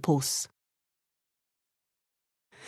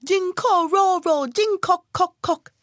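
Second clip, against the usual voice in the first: high chanted toad croak; sing-song toad call, much higher; little toad calling back; 12 semitones higher than usual. A narrator's reading. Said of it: "then he heard".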